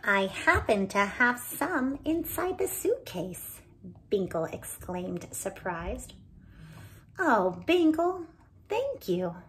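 put-on lively read-aloud for children; animated storyteller voicing each character; story narration. A narrator's reading. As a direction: tell it like a bedtime storybook. Reading picture book aloud.

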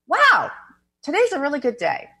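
high, upbeat "wow" opening; delighted wonder. Acted. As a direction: exclaim it like a happy discovery. Models positive self-talk.

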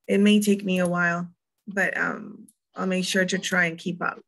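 ordinary talk

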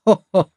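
loud, high scornful ho-ho laugh; mocking laugh. A narrator's reading. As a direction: laugh him off with contempt. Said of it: "höhnte der Fremde".

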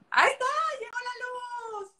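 high, loud joyful shout; excited cheer. Real, not acted. Host cheering when her light finally turns on, high excited shout.

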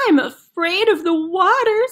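a nervous chicken balking at the jump; scared chicken. Acted.

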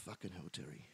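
very quiet, sighing aside; low, barely voiced reaction; hushed dismay. Real, not acted. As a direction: let it out softly on a sigh. Sighs after Terry's story.

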